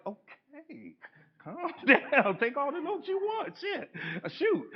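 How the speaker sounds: high, laughing, half-joking calm-down; laughing mock reassurance. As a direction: brush him off through laughter. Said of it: "I'm like".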